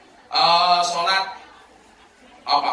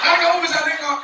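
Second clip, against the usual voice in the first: high-pitched challenge; acted exasperated questioning; incredulous policeman; 11 semitones higher, 3 dB louder, faster than usual. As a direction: protest in baffled disbelief, voice way up.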